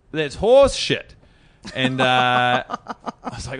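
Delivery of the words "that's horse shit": slow, high, loud indignant outburst; indignant friend. Retold or imitated. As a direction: drag out horse shit in outrage. Friend angrily objecting.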